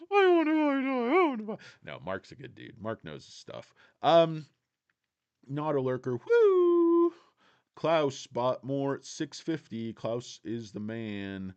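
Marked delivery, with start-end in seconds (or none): 0.1-1.6 high, loud mocking whine; mock the viewer as a smug eager buyer; smug whiny mock. Mocking a chat viewer, high loud smug whine. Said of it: "smug, smiling face just going". 6.2-7.1 excited woo. Real, not acted. Cheering a viewer's comment.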